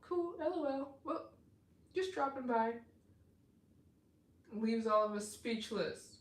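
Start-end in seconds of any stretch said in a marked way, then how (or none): 0.0-2.7 high, offhand put-on voice; nonchalant Kevin voice. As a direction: toss it off like it's nothing. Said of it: "Be like".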